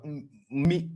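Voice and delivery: ordinary talk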